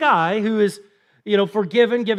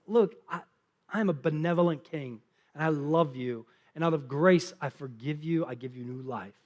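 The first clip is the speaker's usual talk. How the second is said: benevolent king; 5 semitones lower, 6 dB quieter than usual. Acted. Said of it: "He's like".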